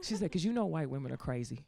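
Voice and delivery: low, soft warning; imitated mom. Mom warns daughter on the phone, low imitated voice. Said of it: "She's like".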